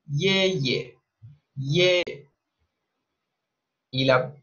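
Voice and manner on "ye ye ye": "ye" in Mandarin tones; Chinese word. Shown as a demonstration. Teacher teaching the word for grandfather. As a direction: model "ye" for learners. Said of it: "la palabra abuelo se dice".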